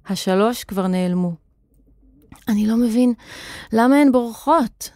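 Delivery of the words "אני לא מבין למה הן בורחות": puzzled, out of breath from climbing; winded, exasperated question; panting. A narrator's reading. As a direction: speak between acted breaths. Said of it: "אמר מתנשף".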